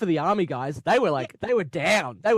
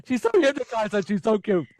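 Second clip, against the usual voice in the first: higher, louder cooing over her; gushing; 5 semitones higher, 4 dB louder than usual. Retold or imitated. Fan recalls gushing over Sarah Jane, loud high coo. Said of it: "I was like".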